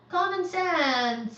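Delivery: high, loud, drawn-out name; emphatic thanks. Real, not acted. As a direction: lean into the name with delight.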